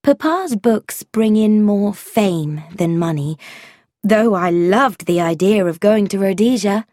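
plain reading voice

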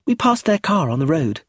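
put-on matter-of-fact child. A narrator's reading. Child explains how she knew of arrest, matter-of-fact.

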